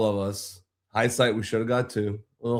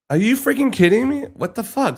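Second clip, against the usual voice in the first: pitched way up, louder, incredulous; exasperated outburst; 10 semitones higher, 5 dB louder than usual. Retold or imitated. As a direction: blurt it out in disbelief and outrage. Outraged at wife being singled out.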